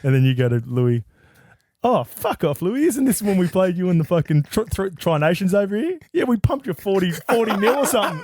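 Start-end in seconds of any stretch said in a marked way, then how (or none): 1.8-8.2 mock taunt. Taunting Louis on the bus.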